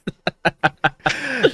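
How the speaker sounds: high, breaking-up laugh. Real, not acted. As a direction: crack up at your own joke. Laughs at own parody.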